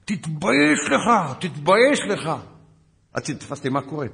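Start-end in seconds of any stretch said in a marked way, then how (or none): 0.0-2.3 high, loud mock yell with drawn-out words; stretched-out, high-pitched shouting; screaming scolding. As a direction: imitate an outraged sergeant tearing into someone. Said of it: "הוא התנפל עליי בצרחות".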